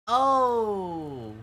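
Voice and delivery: long, high, loud "oh!" sliding down; sudden realization "oh!". Real, not acted. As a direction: let out a drawn-out "oh!" as it comes back. Sudden recall after losing his place.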